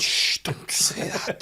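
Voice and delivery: slow, unvoiced child's shush; shushing whisper. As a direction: hiss it to stop the bad word. Daughter shushing dad's swear.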